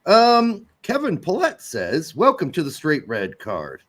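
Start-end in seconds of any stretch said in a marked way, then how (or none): none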